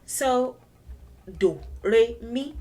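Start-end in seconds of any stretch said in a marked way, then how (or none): 1.4-2.5 spoken tone ladder on "do, re, mi"; three tones. Shown as a demonstration. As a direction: say "do, re, mi" climbing one tone each. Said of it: "are the three tones".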